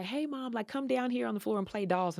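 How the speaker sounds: a hint of a small child asking; imitating her child. Voices her kid asking to play.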